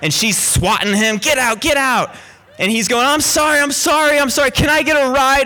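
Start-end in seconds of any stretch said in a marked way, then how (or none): 1.2-2.1 high, insistent imitated order; retold stern shooing. Small woman swatting an intruder, retold stern high order. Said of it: "she's got this real sternness". 3.1-5.4 high, imitated pleading; hurried mock apology, pitched up; retold pleading apology. Intruder being swatted, retold high pleading.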